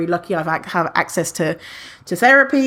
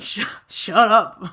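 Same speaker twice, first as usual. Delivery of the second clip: mock annoyed listener; slower than usual. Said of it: "you'll be like".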